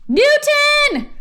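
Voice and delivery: high, loud wail of a name; very high panicked shout; anguished cry. A narrator's reading. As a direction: cry out in horror. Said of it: "cried Stink".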